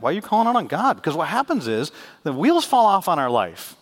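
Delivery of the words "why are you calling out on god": challenging. Acted. Preacher's rising challenge.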